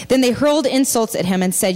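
usual speaking voice

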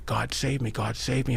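ordinary talk

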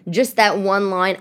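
ordinary talk

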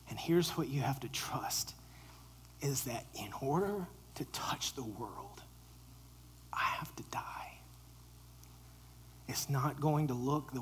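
soft intimate whisper. Real, not acted. Preacher whispering Jesus' words to the congregation. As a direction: draw them in with a hushed voice.

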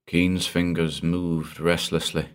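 plain reading voice

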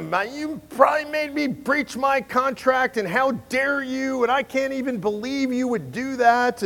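high mock tirade, indignant; angry rant. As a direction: keep ranting, offended and incredulous. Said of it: "He's like".

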